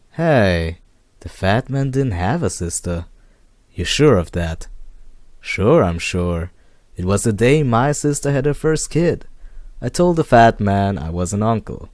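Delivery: loud, excited barman's voice; bartender Joe. Acted. Barman Joe answering the detective, loudly sure of himself.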